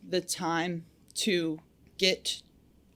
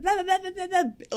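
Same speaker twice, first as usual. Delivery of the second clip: much higher gibberish rant in a put-on voice; mock angry rant; 14 semitones higher than usual. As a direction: imitate an angry foreman's babble. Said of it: "going off and being all angry".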